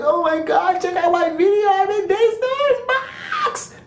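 high, squealing fan voice; mock an overeager newbie; excited newbie vlogger. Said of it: "shaky camera like".

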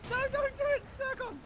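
high-pitched cry of dismay; alarmed protest. Real, not acted. Player begs teammate not to block, alarmed high protest. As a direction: plead with them in a high voice.

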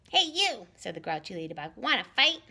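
high, loud challenge; grouchy ladybug voice. Acted. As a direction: pick a fight, cocky and loud. Grouchy ladybug picks a fight.

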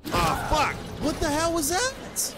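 very high, loud shriek of alarm; startled yell. Real, not acted. Player hit out of nowhere in a game, startled yell. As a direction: yell it out in shock, drawing out the question.

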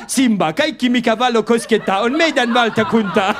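slow, solemn mock proclamation, laughing; hammed-up wise king; grand Lion King line. Driver to passenger at sunrise, mock solemn and slow.

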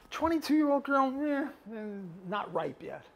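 dismissive "eh", falling away at the end; mock disinterest. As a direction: play at being unimpressed. Acted tribe rejecting younger woman.

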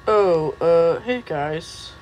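ordinary talk, nothing marked

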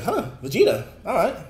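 high, loud, impressed reaction; surprised hero voice. Retold or imitated. Goku takes in Bulma's news, high and surprised. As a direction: pitch it up in mild wonder.

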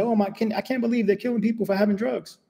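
high mock gasp of disbelief; shocked disbelief. Imagined shocked passengers on plane.

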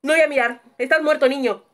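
loud, frantic babble to yourself; panicked pleading. Real, not acted. Player begs that nobody comes, panicked pleading. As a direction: beg at full volume, pitch climbing on the repeats. Panicked hiding, begging not found.

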